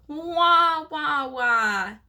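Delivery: loud barking attempt at a whinny; dog-like whinny. A narrator's reading. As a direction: try to whinny but sound like a big dog. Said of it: "more like a large dog".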